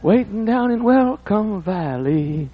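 sung high like a tenor, slow. Retold or imitated. Preacher imitating a gospel quartet tenor, high sung. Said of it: "That high tenor go".

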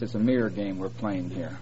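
ordinary talk

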